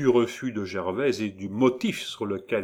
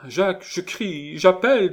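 acted high-pitched desperate protest; desperate threat; 11 semitones higher, 7 dB louder than usual. A narrator's reading. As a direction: threaten to scream, rising in volume. Gervaise threatens to cry out.

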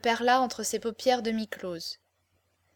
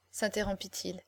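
usual speaking voice

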